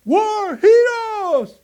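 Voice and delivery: high, loud, slow cheering shout; shouting crowd. Retold or imitated. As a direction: cheer them like a crowd yelling. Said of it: "le gritaban". (grito de multitud vitoreando)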